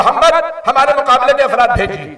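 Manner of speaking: rushed, lightly amused retelling; quick retold demand. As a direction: toss off the enemy's demand fast. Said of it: "कहा". (जल्दी-जल्दी, हल्के अंदाज़ में दोहराया)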